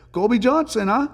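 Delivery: light mock French-accented greeting. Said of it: "in a French accent".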